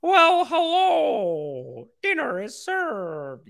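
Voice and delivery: mock Jordan Peterson serving dinner; Jordan Peterson impression. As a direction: ham up the pompous professor announcing dinner. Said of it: "his voice is gonna be like".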